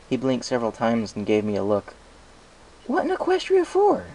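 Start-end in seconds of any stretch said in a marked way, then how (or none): 2.9-4.1 put-on much higher, incredulous young dragon; very high, baffled little dragon; Spike's voice. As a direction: squeak out the disbelief.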